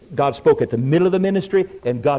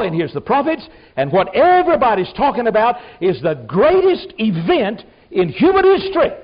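high, loud, building sermon cry; impassioned shouting preacher; preaching at full force; 6 semitones higher, 7 dB louder than usual. Real, not acted. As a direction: lift it to a shouted climax, hallelujah. Preacher climaxing on greatest event.

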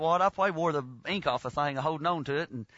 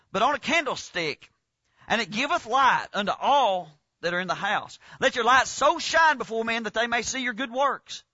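measured, slightly raised scripture read; 3 semitones higher than usual. Acted. Preacher reading Matthew five to the congregation, measured read.

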